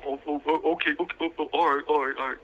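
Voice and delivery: a put-on, flustered retreat, imitating; hammed-up nervous okay-okay; imitating a loudmouth who suddenly caves; mock backing down. Imitating New York backing down.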